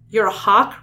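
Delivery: loud, mocking question; sneering. Rachel challenging Tobias on being a hawk, sneering. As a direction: throw it back with an acted sneer. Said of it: "Rachel sneered".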